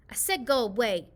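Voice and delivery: put-on child snapping at her friend. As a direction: shoo her off crossly. Hannah sends Lizzie away.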